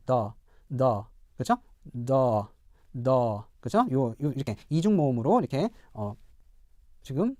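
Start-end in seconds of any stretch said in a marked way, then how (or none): 0.0-3.4 British door, written "the", long vowel without R; British non-rhotic. Shown as a demonstration. Teacher contrasts with American, British R-less door. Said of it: "R 사운드를 하지 않고".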